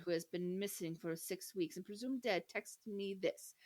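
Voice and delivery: normal talking voice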